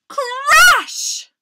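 high, loud sound-effect word; shouted crash. A narrator's reading. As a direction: shout it like the impact itself. Storyteller voices the crash.

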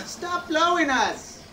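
slow, high, drawn-out yell at the wind; shouting over wind. Pretend. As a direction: stretch it out, yelling at the gusts.

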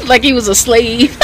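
laughing through the words; giggling as she tells it. Real, not acted. Laughing while telling it.

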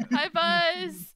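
big, high, loud hello; shouted greeting. Real, not acted. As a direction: yell hello right after a laugh.

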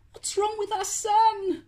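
a hint of a fretting dad; worried dad wondering. A narrator's reading. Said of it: "wondered Dracula dad".

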